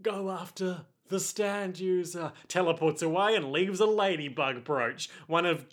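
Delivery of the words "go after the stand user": soft, fading plea; weak dying plea. Acted. Wounded Giorno pleads softly with Narantia. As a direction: call out weakly as he vanishes. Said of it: "Giorno reaches out and is like".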